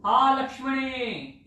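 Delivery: high, loud, very slow cry calling out; a distressed prince's cry drawn out long; Rama's voice. Retold or imitated. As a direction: imitate Rama calling for help across the forest. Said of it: "in almost Rama's voice".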